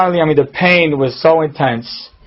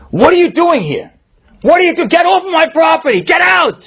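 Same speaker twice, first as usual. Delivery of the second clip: much higher, outraged yell; furious shout; 13 semitones higher than usual. Retold or imitated. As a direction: bellow it to chase someone off. Yitzi angrily confronts man at door.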